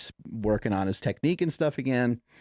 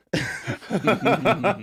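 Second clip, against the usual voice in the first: 1 high burst of laughter; 10 semitones higher, 4 dB louder than usual. Real, not acted. Laughs at own story.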